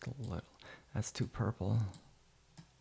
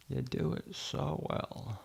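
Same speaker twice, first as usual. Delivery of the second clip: soft hushed take; 5 semitones higher, 8 dB quieter, slower than usual. Shown as a demonstration. Editor praising himself, soft and slow. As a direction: drop it to a soft, slow murmur.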